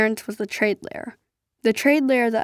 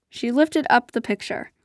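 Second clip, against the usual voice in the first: ordinary delivery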